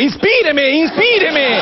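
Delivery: ruega "inspíreme" agudo y fuerte; súplica exagerada. Retold or imitated. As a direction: implora dramático a la novia. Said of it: "le dice a la mina". (cantante romántico rogando a su mina que lo inspire)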